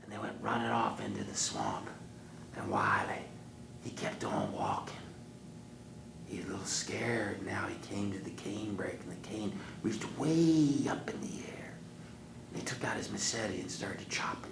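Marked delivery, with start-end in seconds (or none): 3.8-14.5 hushed whispered storytelling; suspense whisper. Acted. Storyteller building suspense for the kids, whispering.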